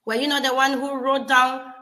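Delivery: usual speaking voice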